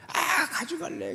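put-on high, slow sulky whine of a child; whiny child voice. Preacher mimics a child throwing a tantrum, high whine. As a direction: whine it like a stubborn kid. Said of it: "막 땡깡 부리는 거예요".

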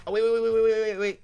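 loud, high rapid waits; urgent excited repeats. Real, not acted. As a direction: stop yourself in a rush. Suddenly remembers under time pressure.

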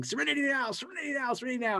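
high, strained mock yell of serenity now; Jerry Stiller yelling. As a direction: squeeze the words out like a man about to blow. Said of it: "he's yelling".

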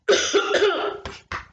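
sharp dry cough. Real, not acted. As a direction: cough from a tickling throat. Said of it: "my throat is tickling".

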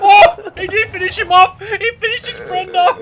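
loud high laughing disbelief; laughing shout. Real, not acted. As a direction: crack up while yelling. Enemy runs over friend.

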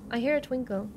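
ordinary talk, nothing marked

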